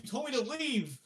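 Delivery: high-pitched protest; imitated kid defending himself; defensive protest. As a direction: answer back in a high voice. Protesting back to mom in story.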